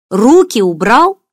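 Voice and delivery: loud, high, slow command; high, drawn-out warning to back off; sharp rebuff. A narrator's reading. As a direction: cut him off, loud and firm. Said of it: "отрезала Даша".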